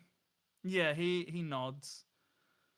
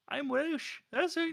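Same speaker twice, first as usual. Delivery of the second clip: high, strained attempt at a Welsh accent; botched Welsh lilt, pitched up; failed Welsh accent; 13 semitones higher, 6 dB louder, slower than usual. Shown as a demonstration. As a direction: try a Welsh accent and miss. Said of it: "No, it's not a Welsh accent".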